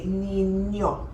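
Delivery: "niño" slowly, with a "ny" sound; tilde N sound. Shown as a demonstration. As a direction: draw out "niño" as "neen-yo". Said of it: "when there's a tilde on the N".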